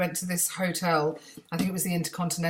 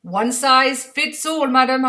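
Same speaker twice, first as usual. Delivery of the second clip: slow, high, loud seller's promise; Indian seller; 6 semitones higher, 7 dB louder, slower than usual. Retold or imitated. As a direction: drawl it like a cheeky market seller. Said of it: "they're going".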